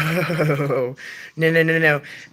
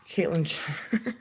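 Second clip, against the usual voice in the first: whispered aside; 7 dB quieter than usual. Real, not acted. Reading a chat comment aloud.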